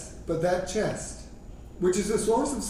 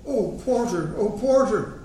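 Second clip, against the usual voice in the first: higher, restrained narrated cry for help; mildly agonised shout; 4 semitones higher, 3 dB louder than usual. A narrator's reading. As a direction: call out to the porter, a touch louder the second time. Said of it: "shouted in agony".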